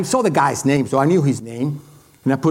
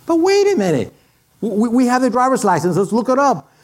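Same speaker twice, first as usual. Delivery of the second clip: higher, eager retelling of his wife; lightly voiced wife, keen and quick; wife's eager voice; 6 semitones higher than usual. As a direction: pipe up like a keen wife with an idea. Wife's excited suggestion retold.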